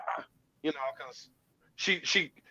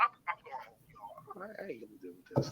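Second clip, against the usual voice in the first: fed-up exit; 14 dB quieter than usual. Retold or imitated. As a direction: mutter it while backing out. Walking away from the fight.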